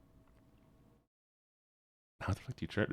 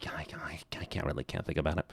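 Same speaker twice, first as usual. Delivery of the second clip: whisper; 10 semitones lower, 7 dB quieter, faster than usual. Real, not acted. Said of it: "whispered aside".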